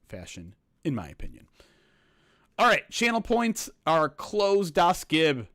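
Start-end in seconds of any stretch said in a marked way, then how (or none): 2.6-5.5 loud, high, slow call-out; announcing. Real, not acted. Host telling viewers channel points are closed, announcing. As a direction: call it out to everyone, drawn out and high.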